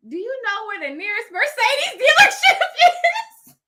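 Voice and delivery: high, loud, laughing question; laughing through it. Real, not acted. Asking the salesman, laughing at herself. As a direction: giggle while asking.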